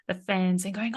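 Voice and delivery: normal talking voice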